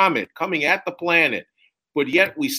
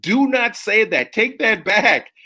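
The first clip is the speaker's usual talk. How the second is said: higher, slow, insistent demand; heated objection; 8 semitones higher, 5 dB louder, slower than usual. Retold or imitated. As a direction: demand a retraction, pressing hard. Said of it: "I got up and I said".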